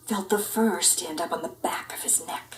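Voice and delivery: soft whispered narration; spooky whisper. A narrator's reading. Narrator building suspense, soft whisper. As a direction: whisper it eerily.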